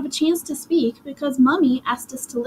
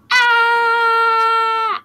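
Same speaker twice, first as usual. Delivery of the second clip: high piercing scream, much louder; 12 semitones higher, 6 dB louder than usual. A narrator's reading. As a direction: let out a long shrill scream of panic. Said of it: "I screamed".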